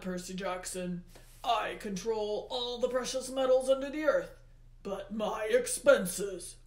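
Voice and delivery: loud, heavily stressed rant; Hades, aggrieved. A narrator's reading. Hades rants to Percy Jackson about expenses, aggrieved. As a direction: complain at full voice like an overburdened god.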